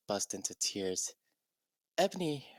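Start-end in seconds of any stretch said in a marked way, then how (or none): 2.0-2.5 a hint of a worried friend's call; lightly voiced concerned friend. Friend checking on Ebony, lightly worried. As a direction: call her name, mildly concerned. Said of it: "a Bloody Mary asked".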